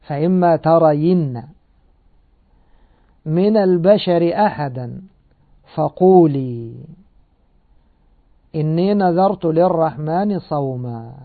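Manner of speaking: slow, measured tajweed chant of the verse; Quran recitation. Acted. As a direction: recite it as in prayer, drawing out the vowels. (تلاوة مرتلة بطيئة)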